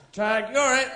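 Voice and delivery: high, frantic dog voice; excited dog. Acted. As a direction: yap it out like a car-mad dog. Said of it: "They catch up with the car".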